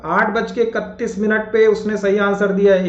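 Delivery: normal talking voice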